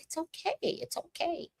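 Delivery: high, soft soothing; soft comforting. Retold or imitated. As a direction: coo it gently to calm him. Comforting crying husband.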